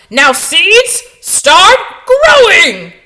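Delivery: full shout, high and slow. Acted. Toad yelling at the ground at his seeds, full shout. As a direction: bellow at the seeds to grow. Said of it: "and shouted".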